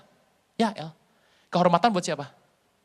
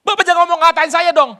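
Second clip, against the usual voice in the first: high, loud offended cry; a woman snapping at the pastor not to insult her; indignant woman's protest; 11 semitones higher, 12 dB louder than usual. Retold or imitated. Woman protesting the pastor's words, high and loud.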